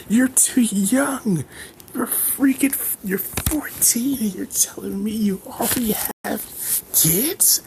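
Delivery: high, shrieking mock outrage from a mother; hammed-up furious mom, voice climbing very high; scandalized mother yelling at her son, high-pitched; angry mother. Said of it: "absolute anger".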